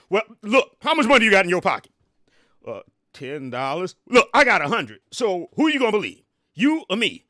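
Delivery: a rich braggart waving money at a voter, loudly; loud brag. Retold or imitated. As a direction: flaunt the cash and shout him down. Acted rich man bragging.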